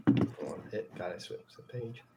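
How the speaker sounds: ordinary talk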